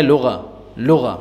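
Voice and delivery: لغہ with a single light gh, no doubling; correct pronunciation. Shown as a demonstration. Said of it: "غین پر جو ہے نا شد نہیں ہے".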